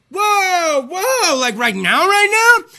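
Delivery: high, loud mock shock; alarmed disbelief. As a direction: yelp it in retold alarm. Told to go to ER.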